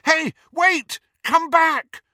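high, loud shout after someone; calling out. A narrator's reading. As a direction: call urgently to stop them leaving. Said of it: "He had not meant to shout".

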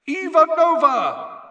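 high, loud, drawn-out shout; announcer's name call. Acted. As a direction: belt the name out across a stadium. Announcer calls out players' names.

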